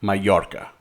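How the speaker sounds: MALLORCA the Spanish way, ma-YOR-ka; Spanish pronunciation. Shown as a demonstration. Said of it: "It's what an Spaniard could say".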